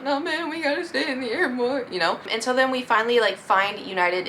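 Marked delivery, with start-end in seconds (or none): 0.0-1.9 high, choked-up imitation; retold lump-in-the-throat protest; mock near-tears. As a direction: whine it as if about to cry. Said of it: "lump in my throat".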